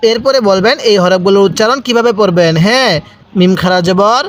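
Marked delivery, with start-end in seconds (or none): none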